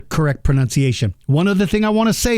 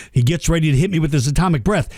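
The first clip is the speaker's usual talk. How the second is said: normal talking voice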